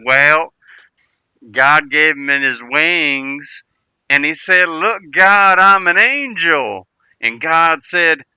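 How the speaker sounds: loud, high imitated Southern old-timer; hammed-up Southern joke-telling, building to a shout; thick Southern accent. Old vet telling a joke, loud thick Southern accent. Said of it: "had, like, an extremely thick Southern accent".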